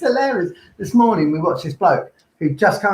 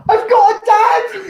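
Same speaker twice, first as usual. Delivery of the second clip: very high, loud, slow squeal; hammed-up shout of astonished joy; overjoyed amazement; 8 dB louder, much slower than usual. Imitates patient learning he has a dad, overjoyed shout. Acting out man after anaesthetic.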